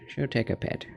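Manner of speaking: "She'll take a pet." whispered softly; whispered repeat. Real, not acted. Petting the cat.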